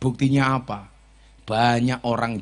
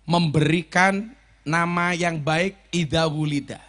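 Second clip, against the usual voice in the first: slow emphasis; 2 semitones higher, 6 dB louder, slower than usual. Acted. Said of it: "slow emphatic teaching".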